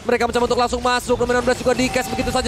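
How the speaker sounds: fast, rising hype play-by-play; fighting game announcer. Acted. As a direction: build to the game-winning call. Climactic play-by-play of final wipe.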